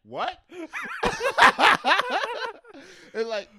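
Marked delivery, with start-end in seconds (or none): 0.0-0.4 loud, high shocked what. Acted. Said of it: "he looked up like". 0.4-3.1 very high loud shrieking laugh. Real, not acted. Explosive laugh.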